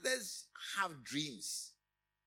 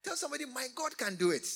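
loud, higher rallying call to the crowd; preaching at full force; 9 semitones higher, 8 dB louder, faster than usual. Acted. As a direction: fire up the congregation to shout it. Preacher urges congregation to declare.

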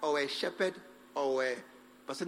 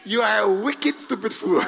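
laughing scold; 6 dB louder than usual. Real, not acted. Mock scolding amid laughter.

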